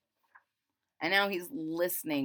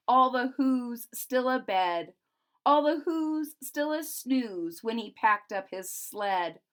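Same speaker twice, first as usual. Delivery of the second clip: put-on rhythmic read-aloud to children; storybook narration; 3 semitones higher, 4 dB louder than usual. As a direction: lilt the rhyming verse. Reads the Grinch book aloud.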